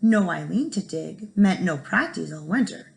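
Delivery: plain reading voice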